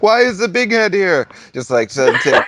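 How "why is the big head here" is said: imitating old woman. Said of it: "being like".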